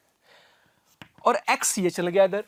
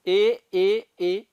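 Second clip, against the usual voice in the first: ए four times a second, each one spaced apart; slow separate sounds; much slower than usual. Shown as a demonstration. Teacher showing students four separate ए sounds a second, spaced. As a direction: say each ए as its own distinct beat. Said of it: "ए चार बार बोला".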